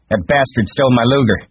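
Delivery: high, loud indignation; angry shout. A narrator's reading. Carlson runs in reporting his gun stolen, shouting. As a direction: shout out the theft. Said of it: "he shouted".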